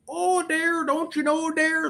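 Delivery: sing-song mockery of a Fargo accent, slowing on don't you know. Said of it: "they're not going".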